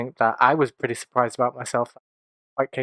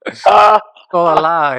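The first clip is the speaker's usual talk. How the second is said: slow, louder, hammed-up doom; mock dramatic confession; 5 semitones higher, 9 dB louder, much slower than usual. Co-host declaring the voices a lie, in slow mock drama. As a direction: drag it out like a grand confession.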